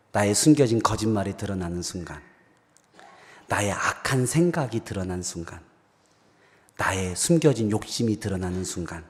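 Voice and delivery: low, soft, measured preaching; quiet, grave appeal to the congregation; solemn reflection. Real, not acted. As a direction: bring it down to a hushed, searching tone. Preacher turns story into solemn reflection.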